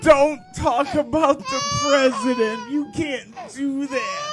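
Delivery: high fake-crying whine; whiny man child. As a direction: blubber it like a big baby. Mocking the kid as crybaby.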